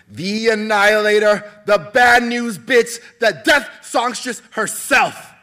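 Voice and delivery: high, loud, slow ring announcer; arena announcer hype. Acted. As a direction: stretch it out for the roaring crowd. Announcer introduces champion.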